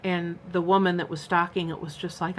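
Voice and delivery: ordinary talk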